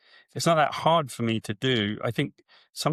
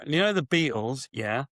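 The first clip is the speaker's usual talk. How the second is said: usual speaking voice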